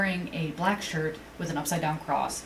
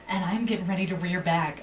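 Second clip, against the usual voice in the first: ordinary delivery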